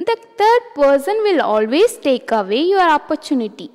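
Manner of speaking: ordinary talk, nothing marked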